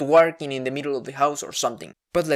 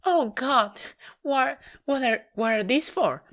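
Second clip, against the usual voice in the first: higher, shocked mom voice; alarmed mom; 9 semitones higher than usual. Retold or imitated. Mom shocked by the hormone pills, high and alarmed. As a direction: gasp it out in alarm. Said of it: "And my mom goes".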